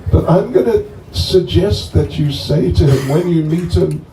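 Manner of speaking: normal talking voice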